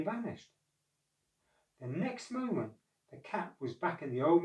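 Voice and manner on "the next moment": ordinary delivery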